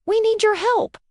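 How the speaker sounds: high, urgent blurt; blurted plea. A narrator's reading. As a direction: burst out all at once. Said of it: "they all blurted out".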